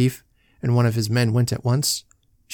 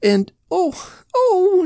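high rising wail, louder on the last oh; moaning; 3 dB louder, much slower than usual. Acted. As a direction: moan it out in rising distress. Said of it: "she moaned".

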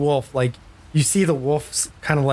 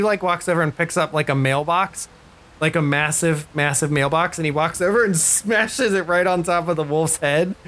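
ordinary talk, nothing marked

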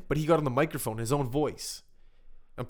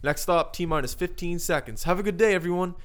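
bus driver announcement; 5 semitones higher, 4 dB louder than usual. Retold or imitated. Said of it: "so this guy".

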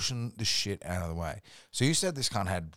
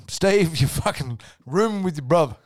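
mum's order; 8 semitones higher, 9 dB louder than usual. Retold or imitated. Mum ordering son.

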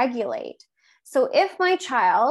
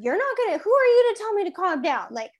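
higher, outraged, mock-angry comeback; mock indignant retort; 5 semitones higher than usual. Wife mock-outraged at her husband telling her to calm down, higher and indignant. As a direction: snap back in playful outrage at a husband. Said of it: "I would be like".

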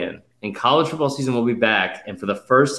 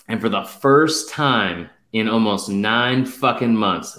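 slow dramatic build; 2 semitones lower, slower than usual. Acted. Dramatic buildup to season opener.

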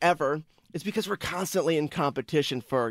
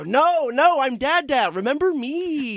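much higher, pleading sing-song; 9 semitones higher, slower than usual. Retold or imitated. Dad pleading with baby to say his name, high sing-song. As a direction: beg the baby to remember you. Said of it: "And I go".

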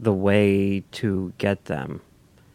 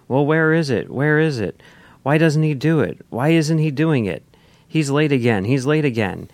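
higher, louder mock complaining, each question falling away; hammed-up pestering fan outcry; imitated screaming fans; 6 semitones higher, 5 dB louder than usual. Host quoting impatient internet fans, mock clamouring. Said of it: "fans on the internet start screaming".

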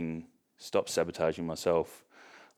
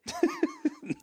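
high laugh. Real, not acted. Recalling the awkward silence, a high laugh.

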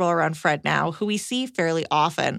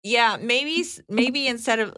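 usual speaking voice

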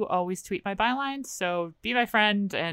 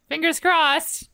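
drawn-out hopeful; 9 semitones higher, 5 dB louder, much slower than usual. Real, not acted. Wishing hopefully after gloomy prediction.

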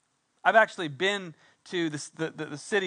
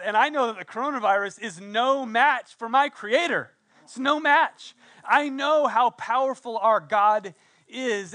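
rising, louder preacher's proclamation; fervent sermon climax, louder and higher; preaching; 4 semitones higher, 5 dB louder than usual. Acted. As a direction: build to a triumphant declaration of faith. Preaching rises to full force.

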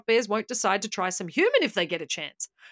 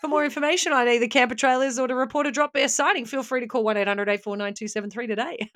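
mock ad read; 4 semitones higher than usual.

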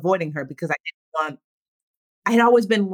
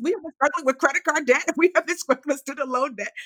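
high, fast incredulous friend; higher, laughing best friend; friend's incredulous voice; 7 semitones higher than usual. Retold or imitated. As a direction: set her straight with disbelief. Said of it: "she was like".

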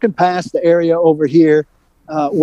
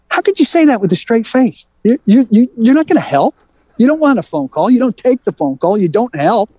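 higher-pitched, incredulous retelling of a confrontation; indignant challenge; 7 semitones higher than usual. Retold or imitated. Reporter retelling how he confronted an agent, indignant. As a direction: imitate yourself challenging someone, pitched up.

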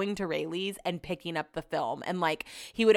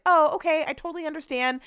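mock polite; 8 semitones higher than usual. Fake polite reply to hosts.

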